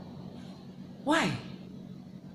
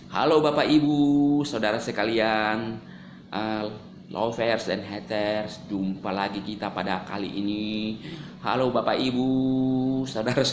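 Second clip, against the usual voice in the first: slow, low, softening host opening; announcer greeting; 7 semitones lower, slower than usual. Shown as a demonstration. Vlogger demonstrates his intonation with a slow host greeting. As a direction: greet the audience like a broadcast host. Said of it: "Saya bisa mengatur intonasi bicara".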